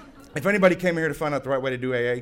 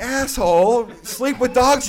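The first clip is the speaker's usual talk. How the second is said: high, loud scolding; blunt rebuke; 11 semitones higher, 10 dB louder, slower than usual. Retold or imitated. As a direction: snap it back at him. Voicing group's blunt reply.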